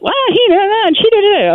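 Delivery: put-on high, whiny child blaming sibling; whiny kid voice. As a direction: squeak it out like a tattling kid. Kids voiced in retold scene.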